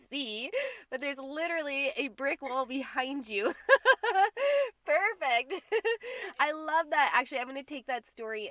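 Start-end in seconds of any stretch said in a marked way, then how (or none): 3.6-4.8 high, loud burst of laughter; real delighted laugh. Real, not acted. Delighted by the brick wall.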